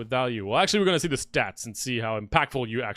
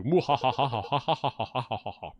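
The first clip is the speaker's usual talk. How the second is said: evil cackle; 2 semitones lower than usual. Acted. Boss's laugh after its lines.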